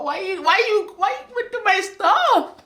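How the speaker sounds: very high, plaintive whine; disappointed. Retold or imitated. Daughter disappointed that mom searched her stuff, plaintive wail. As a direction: wail it slowly, pitch way up. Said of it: "I'm also disappointed".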